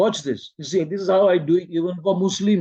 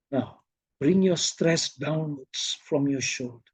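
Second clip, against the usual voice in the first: softer, measured relaxation cue; guiding emphasis; 3 semitones lower, 5 dB quieter than usual. Shown as a demonstration. Teacher showing how to release shoulder stress, softly guiding. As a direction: ease down into a hushed instruction. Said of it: "How you emphasize?".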